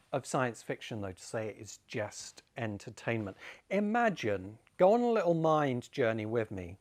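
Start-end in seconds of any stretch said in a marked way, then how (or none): none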